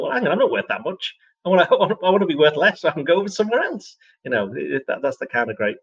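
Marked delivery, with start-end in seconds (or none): 0.0-4.0 mocking player quote. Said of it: "they go".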